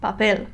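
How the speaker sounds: papel said firmly with stress on PEL; last syllable stressed. Shown as a demonstration. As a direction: hit the final syllable of papel. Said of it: "I stress the last syllable".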